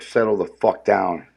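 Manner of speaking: ordinary delivery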